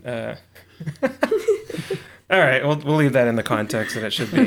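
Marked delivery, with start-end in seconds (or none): 0.8-2.0 high laughing burst. Real, not acted. Laughing at the joke.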